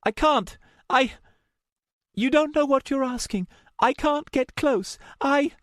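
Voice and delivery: high, agitated pleading; frightened high-pitched refusal; panicked. A narrator's reading. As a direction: protest in panic, breaking off. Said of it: "breathing fast".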